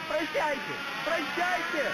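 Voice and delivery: very high, desperate goodbye cry; shouted farewell. Acted. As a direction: call out goodbye as you're dragged away. Shouted farewell while dragged off.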